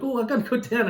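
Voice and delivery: sudden keen mock excitement; eager excitement. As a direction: jump up, can't wait to go. Retold own eager reaction.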